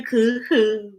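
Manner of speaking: ordinary delivery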